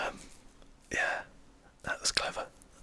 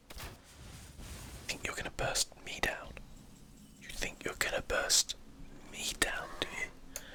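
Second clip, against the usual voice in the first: taunt; 4 dB quieter, slower than usual. Acted. Taunting opponent during match.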